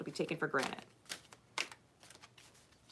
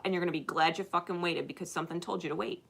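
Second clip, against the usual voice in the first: usual speaking voice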